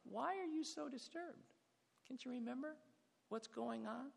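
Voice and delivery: high, soft, coaxing questions; inquisitive hope. Shown as a demonstration. Psalmist questioning his soul in light of God's love, softly hopeful. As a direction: gently puzzle it out, light and hushed. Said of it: "maybe his tone's changed".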